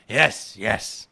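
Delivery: loud, slurred tipsy lord; drunken viscount voice. Acted. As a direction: bluster like a drunk nobleman. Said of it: "I was really engaged by the Viscount's voice".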